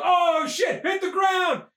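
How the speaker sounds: the crowd yelling as the bomb flies; panicked shout. Acted. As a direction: yell for everyone to hit the ground.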